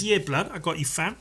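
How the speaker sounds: lightly put-on British street slang accent; easy street-slang accent, lightly sung out; colloquial slang affect. Someone agreeing to help, casual street slang accent. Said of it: "When someone says".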